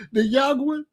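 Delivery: ordinary delivery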